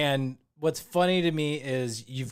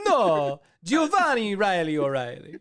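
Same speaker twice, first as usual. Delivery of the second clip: louder, hammed-up Italian name roll; mock Italian accent; 5 semitones higher, 7 dB louder than usual. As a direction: roll Giovanni like a cartoon Italian. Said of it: "It sounded more Italian to me".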